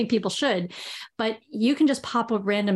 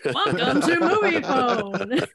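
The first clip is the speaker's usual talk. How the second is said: slow, higher, hammed-up hotline announcer; Movie Phone voice; 4 semitones higher, 3 dB louder, slower than usual. Joking with co-hosts about an old film hotline, mock announcer greeting. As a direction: stretch out a grand recorded greeting, half laughing. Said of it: "find the voice for Movie Phone".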